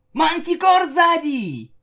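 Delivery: very high, loud, drawn-out cry; stretched high-pitched warning yell; gleeful shout. A narrator's reading. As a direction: shout a playful false alarm. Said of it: "весело крикнула".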